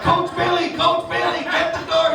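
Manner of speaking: put-on high kid voices calling for the coach. Hockey kids calling to their coach, high and eager. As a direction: shout it like eager little kids.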